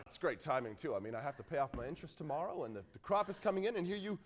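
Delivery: soft, quick grumbling to himself; muttered aside. Acted. Suitor to himself, a soft quick grumble. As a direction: mumble the worries under your breath.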